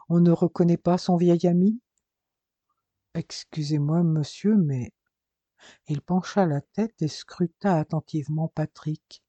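usual speaking voice

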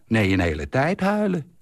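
ordinary delivery

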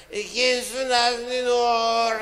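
put-on high, loud, drunken girl voice; high loud drunken slurring; drunk party girl. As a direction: slur it like a tipsy party girl. Drunk girls voiced in joke.

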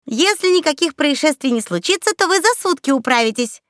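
high, coaxing promise; apologetic encouragement. A narrator's reading. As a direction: reassure them while making excuses. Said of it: "попытался то ли оправдаться, то ли приободрить".

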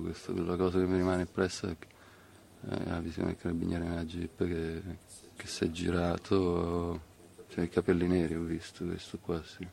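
ordinary delivery

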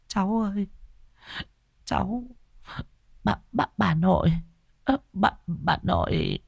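soft, high, faltering grandmother voice; frightened stammer. A narrator's reading. Grandmother backing off from her possessed grandchild, soft fearful stammer. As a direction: stammer in fear, with halting gaps between words. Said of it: "Bà lắp bắp".

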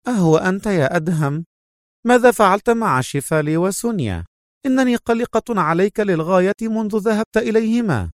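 high-pitched woman, anxious about her partner; worried female agent. A narrator's reading. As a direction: greet him in a high, concerned voice. (صوت امرأة مرتفع النغمة قلق)

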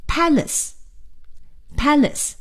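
"palace" as PAL-ace, said twice for learners; PAL-ace, pause, PAL-ace; stress on first syllable. Shown as a demonstration. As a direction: model "palace" with a strong first syllable, light ending. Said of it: "是在第一音节".

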